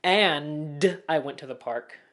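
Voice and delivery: slow, over-enunciated "And I went to the park"; a touch stiff and exaggerated on "And"; over-articulated. Shown as a demonstration. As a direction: hit every consonant of "And" too hard. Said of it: "I'm not going".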